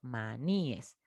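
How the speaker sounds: maníes said low and soft, ma-ní-es; "maníes" quietly sounded out in three syllables; plural maníes demonstrated. Shown as a demonstration. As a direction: say "maníes" with stressed ní and a separate "es". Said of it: "para que suene así".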